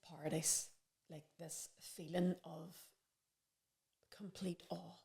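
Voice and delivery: very soft, slow, fading to a whisper; awestruck, trailing into near-silence; hushed awe. Retold or imitated. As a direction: let the words fade away in wonder. Recalling awe after inner hell.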